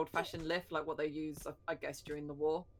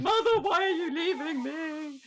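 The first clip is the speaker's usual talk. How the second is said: put-on high, slow, pleading little-kid voice; whiny child voice; 13 semitones higher, 7 dB louder, slower than usual. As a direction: play the abandoned child, dragging it out. Voicing the child as joke.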